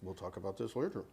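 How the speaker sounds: hushed mutter through still lips; lips barely moving. Real, not acted. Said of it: "use your ventriloquist voice".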